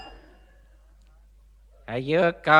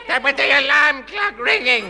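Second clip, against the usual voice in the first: exasperated shout; 8 semitones higher, 5 dB louder than usual. Acted. Exasperated old man shouting.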